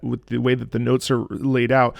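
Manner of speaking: normal talking voice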